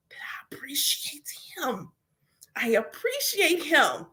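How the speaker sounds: breathy whisper of thanks; whispered gratitude. Retold or imitated. Thanking her ancestors in a tender whisper. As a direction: hush it like a secret prayer.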